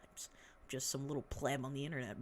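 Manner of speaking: quick self-mocking whisper; hurried little whispered aside. Real, not acted. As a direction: toss it off under the breath.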